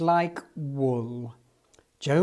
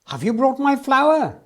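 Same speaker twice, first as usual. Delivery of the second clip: higher, louder demand; shouting; 8 semitones higher, 7 dB louder than usual. Acted. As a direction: call out for the flour. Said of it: "shouted Eli".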